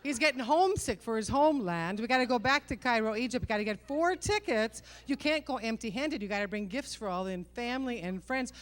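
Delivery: ordinary delivery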